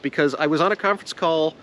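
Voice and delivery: ordinary delivery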